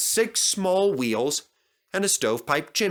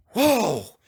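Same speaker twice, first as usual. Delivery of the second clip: high, louder startled cry; horrified gasp; 8 semitones higher, 4 dB louder than usual. Acted. Little engines hearing Culdee's story, horrified high gasp. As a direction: react as if watching a crash. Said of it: "gasped the little engines in horror".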